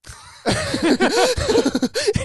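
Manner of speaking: loud, high-pitched burst of laughter; high laughing shriek. Real, not acted. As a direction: crack up loudly and high. Joke lands, long loud laugh.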